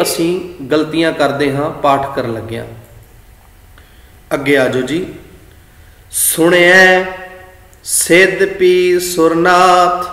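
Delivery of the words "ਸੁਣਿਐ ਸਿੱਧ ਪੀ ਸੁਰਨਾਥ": slow, sustained verse reading; scripture recitation. Shown as a demonstration. Teacher reads the next verse aloud, slow recitation. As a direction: recite with held vowels.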